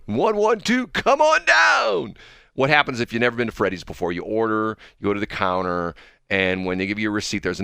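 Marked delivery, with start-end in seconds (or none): 0.1-2.2 high, loud, stretched-out game-show style call; microphone announcer impression. Host mimicking the Freddy's guy calling his number, hammy announcer. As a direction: imitate an announcer summoning a winner, drawing it out. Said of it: "that's the guy on the microphone".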